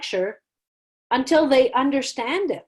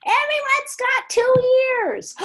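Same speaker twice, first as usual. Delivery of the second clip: put-on much higher, keen kid voice; eager student; 6 dB louder than usual. A bright student shouting out the answer in a much higher voice. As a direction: play the student who gets it. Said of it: "bright lights that will be like".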